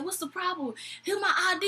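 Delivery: high-pitched imitation of a mad drunk; mock indignant protest. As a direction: demand service as the drunk customer. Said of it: "so freaking mad".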